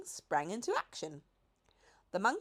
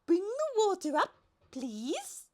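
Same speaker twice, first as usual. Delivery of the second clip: much higher, light giraffe voice; 13 semitones higher, 4 dB louder than usual. A narrator's reading. The giraffe reminds the Duke of his invitation in a high voice. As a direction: play the lofty giraffe.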